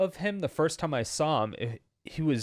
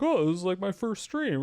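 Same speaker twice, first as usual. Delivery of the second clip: high-pitched teasing imitation of a newbie; mock humblebrag; 6 semitones higher than usual. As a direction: mimic a friend's innocent boast, pitched up. Mockingly voices the gifted newbie friend.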